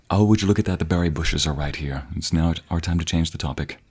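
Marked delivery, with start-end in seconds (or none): none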